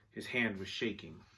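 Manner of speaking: plain reading voice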